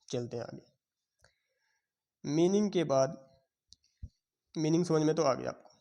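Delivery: usual speaking voice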